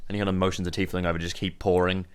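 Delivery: usual speaking voice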